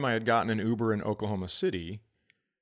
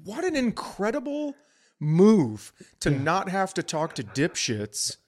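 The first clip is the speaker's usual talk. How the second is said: amazed praise; 7 semitones higher than usual. Retold or imitated. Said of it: "I'm like".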